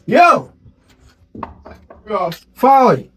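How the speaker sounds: loud, high hail across the yard; loud greeting call from afar. Acted. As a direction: shout to get his attention. Calling out to a friend.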